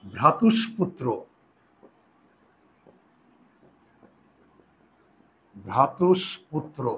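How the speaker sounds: slow, careful repeat of the word; dictating ভ্রাতুষ্পুত্র slowly to students; slow dictation. Shown as a demonstration. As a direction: spell out ভ্রাতুষ্পুত্র slowly for writing. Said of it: "লিখো তো".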